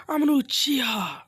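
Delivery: proud rival showing off; arrogant boast. Acted. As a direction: throw the clan name in their faces. Sasuke boasting to classmates.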